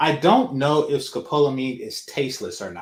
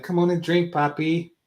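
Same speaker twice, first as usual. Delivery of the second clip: coaxing put-on Spanish accent; mock Spanish accent; 6 semitones higher than usual. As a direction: coax him to drink in a fake Spanish accent. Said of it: "my Spanish accent is just".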